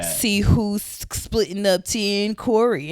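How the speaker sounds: slow, teasing headline read; clickbait headline. Retold or imitated. Mocking gossip blog headlines for listeners, slow and teasing. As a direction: sell the gossip bait.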